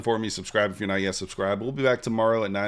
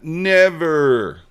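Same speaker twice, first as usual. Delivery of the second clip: Never, hit louder and higher; emphatic never; 6 semitones higher, 9 dB louder than usual. Acted. Denying a dirty joke to chat, with a loud mock-indignant Never. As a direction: slam the word Never down.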